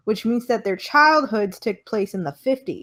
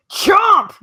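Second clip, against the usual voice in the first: high, loud mock bite sound; loud chomp; 11 semitones higher, 9 dB louder than usual. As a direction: bark out the bite. Pennywise biting arm.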